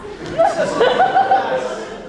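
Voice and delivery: high, loud burst of delighted laughter; joyous laugh. Acted. Jack reunited with Gwendolyn, joyous high laugh. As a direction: laugh out in relief and joy.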